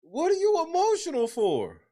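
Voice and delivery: high-pitched, baffled question falling at the end; utterly baffled, pitch way up; incredulous. Real, not acted. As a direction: demand it in amused disbelief. Baffled by the emotional apology.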